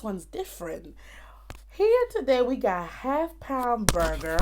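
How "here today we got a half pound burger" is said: slipping into an American accent; lively American accent on the announcement. Real, not acted. Vlogger presenting the burger to viewers, American accent. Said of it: "my accent goes automatically to American".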